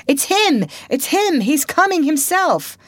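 high, loud crowd shout; excited shout. A narrator's reading. Crowd at the graveyard spotting Potter, high excited shouts. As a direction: cry out as he appears. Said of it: "and voices shouted".

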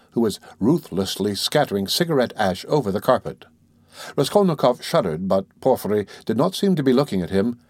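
plain reading voice

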